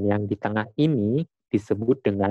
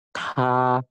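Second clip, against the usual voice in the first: "Ka" as airy-T "tā", held level; first tone syllable; 3 semitones higher than usual. Shown as a demonstration. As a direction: hold "Ka" on a steady raised note, said tā. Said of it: "lalu nadanya yang pertama". ("Ka" dibaca tā, nada datar)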